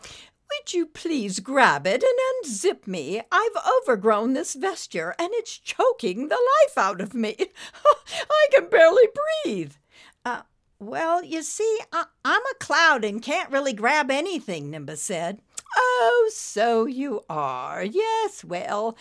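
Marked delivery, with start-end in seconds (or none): 0.0-9.7 high, loud British lady in distress; theatrical British plea with a strained laugh; Lady Meander. A narrator's reading. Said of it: "in a most British stately accent". 10.2-14.7 high, apologetic stammer; sheepish cartoon voice, haltingly; hesitant cloud. A narrator's reading. Cloud awkwardly explains he cannot help.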